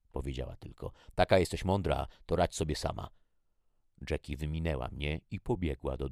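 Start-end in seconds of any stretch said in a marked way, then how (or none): 1.2-3.1 loud jab, easing off at the end; scornful. A narrator's reading. Jackie abandons her sister, scornful. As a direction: throw it at her in anger.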